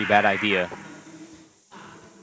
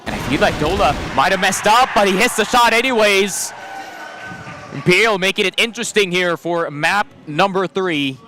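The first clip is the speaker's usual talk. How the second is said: higher, louder hype call; excited casting; 9 semitones higher, 6 dB louder than usual. Real, not acted. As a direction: ride the big shot. Big shot called.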